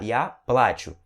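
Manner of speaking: плачу with all the force on PLA; full force on A. Shown as a demonstration. Teacher shows where the force goes, strong A in плачу. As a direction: hit the A of плачу, let chu drop. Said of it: "A força vai toda nele".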